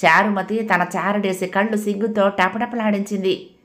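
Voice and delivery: ordinary delivery, nothing marked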